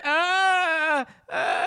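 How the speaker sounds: high drawn-out imitated child's cry; long high wail imitating crying children; mock kids' crying wail. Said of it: "the kids just started crying".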